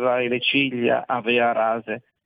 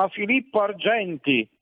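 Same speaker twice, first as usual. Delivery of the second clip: crowd's cry; 6 semitones higher than usual. A narrator's reading. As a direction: call it out like a crowd, restrained. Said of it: "Tutti gridavano".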